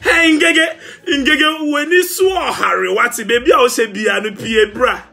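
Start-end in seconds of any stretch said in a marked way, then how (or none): 0.0-2.9 laughing call-out. Real, not acted. Teasing Ngege, laughing and calling out.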